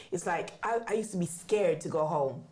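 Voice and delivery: ordinary talk, nothing marked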